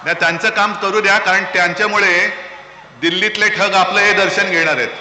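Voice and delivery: loud booming rally address; rally speech. Acted. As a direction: call out to the whole crowd.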